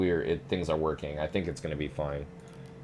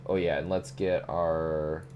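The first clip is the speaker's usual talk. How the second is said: ordinary talk, nothing marked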